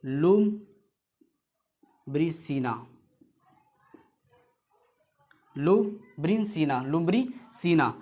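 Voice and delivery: "लुब्रिसिना" stretched out very slowly; slow dictation. Shown as a demonstration. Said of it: "वैज्ञानिक नाम". (बहुत धीरे "लुब्रिसिना")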